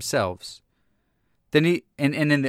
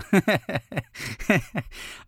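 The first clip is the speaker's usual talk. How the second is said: amused laugh at own joke; 5 semitones higher than usual. Real, not acted. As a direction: burst of chuckling. Laughs at own mock praise.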